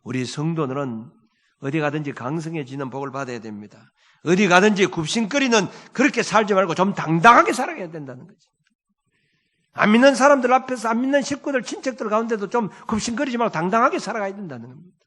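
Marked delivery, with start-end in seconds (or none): 4.2-15.0 loud, high, ringing exhortation; full-force preaching. Acted. Pastor rousing his congregation to stand tall, preaching full force. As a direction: preach it out boldly to the whole congregation.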